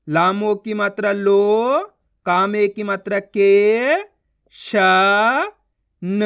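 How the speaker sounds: लो held long and rising, then के, श, न apart; syllable sounding out. Shown as a demonstration. Teacher drilling each sound, sounding out. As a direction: spell slowly with pauses round श and न. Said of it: "उनका उच्चारण करो".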